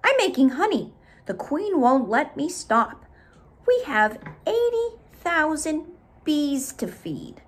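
busy worker bee, no time. Acted.

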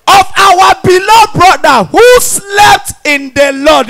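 hammed-up solemn obituary read, high and slow; mock solemn announcement. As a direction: read the obituary like grand news.

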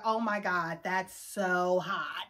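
low, drawn-out mock turn-on; sarcastic, hushed fake swoon. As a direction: pretend to be impressed, slow and soft. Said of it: "Did you think we were gonna be like".

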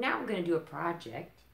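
usual speaking voice

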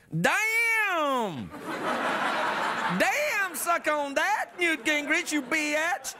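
high, drawn-out exclamation, then gloating trash talk; Mitt Romney. Retold or imitated. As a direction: crow it in a high, hammed-up mock quote. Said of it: "That's how he talks".

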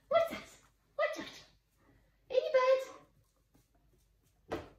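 very high, laughing squeak; squeaky question. Real, not acted. Trainer teasing her dog with a very high squeaky question. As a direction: tease him with a squeaky voice.

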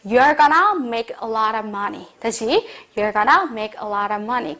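slow, sure "you're gonna make a lot of money"; confident slow model. Shown as a demonstration. Teacher modelling a confident prediction for students. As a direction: say it with conviction, slowly. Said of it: "확신을 가지고 말할 때".

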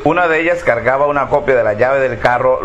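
ordinary delivery

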